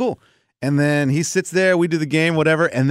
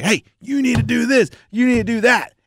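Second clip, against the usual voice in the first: high-pitched mock commands; emphatic, raised-pitch instructions; bossy coach; 9 semitones higher than usual. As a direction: imitate a coach ordering kids around. Imitates coach ordering kids about.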